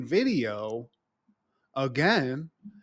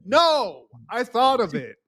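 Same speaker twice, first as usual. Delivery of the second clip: smug refusal; 6 semitones higher, 5 dB louder, slower than usual. Retold or imitated. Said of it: "He's like".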